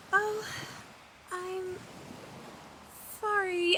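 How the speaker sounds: ordinary delivery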